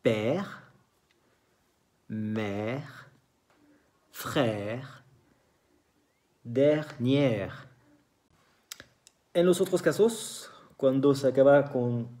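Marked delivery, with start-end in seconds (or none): 0.0-7.7 "père, mère, frère, dernière" drawn out with open E; open-mouthed è. Shown as a demonstration. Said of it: "Entonces, con boca abierta". ("père, mère, frère, dernière" con la e bien abierta)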